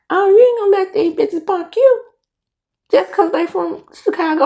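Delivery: high, indignant urging; retold fired-up talk to oneself; defiant self-talk. Retells pep talk to herself.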